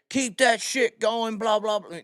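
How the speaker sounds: high-pitched, slowed mock rant; imitating ranting cop. Police officer spewing obscenities at a car, imitated furious rant. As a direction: imitate a cop losing his mind. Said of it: "losing his mind".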